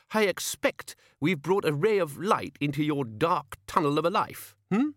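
high, affected servant's voice; sharp-tongued page. A narrator's reading. Page demanding thanks from old man, condescending. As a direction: needle him as the king's snooty page.

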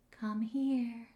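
soft, high, eerie ghost calling. Retold or imitated. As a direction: call out softly like a ghost luring someone. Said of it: "my best American Ghost accent".

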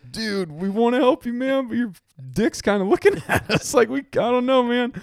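high voice breaking into laughter; laughing. Retold or imitated. Friends laughing while answering Rhodes, voice pitched high. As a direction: try to talk through helpless laughter. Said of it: "can't do anything but just scream laughing".

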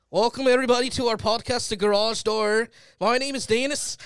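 high, loud attempt at Scottish-Irish; Scottish-Irish accent. Shown as a demonstration. Podcast host saying the intro on request, Scottish-Irish accent. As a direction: announce it in a shaky-aim Scottish or Irish accent. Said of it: "I think it's Scottish".

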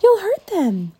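put-on high, loud girl's protest; upset child crying out; distressed cry. A narrator's reading. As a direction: plead loudly for the birds. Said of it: "cried Annie".

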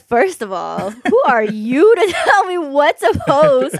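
loud, high mock outrage, laughing midway; indignant comeback. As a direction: drag out the indignant retort through a laugh. Retells indignant comeback to brother.

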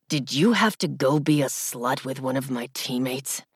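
rough, raw accusation. Acted. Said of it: "his words coming out rough and raw".

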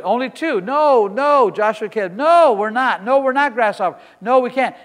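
loud, rising preacher's cry; fiery preaching. Real, not acted. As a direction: build the decree like a revival preacher. Preaching at full force.

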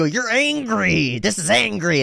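high, slow mock-angry declaration; name the anger while acting it out; mirroring anger. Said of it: "And, and you go".

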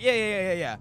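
loud, raspy rapper ad-lib; exaggerated raspy hype-man voice. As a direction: rasp out a rapper's repeated ad-lib. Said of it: "doing a [name] impression".

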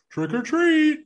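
high, loud put-on kiddie voice; silly high-pitched child voice; stupid baby voice. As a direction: call it out high, speaking for a shy toddler. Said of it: "in the stupid voice".

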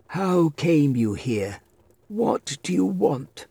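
put-on high, slow, severe old voice; stern old man. A narrator's reading. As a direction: demand it like a stern old master. Stern old master challenges stranger.

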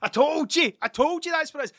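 high, loud triumphant crowing; gloating. Retold or imitated. Uncle crowing at friends he was right, high and loud. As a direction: crow it like you just won the bet.

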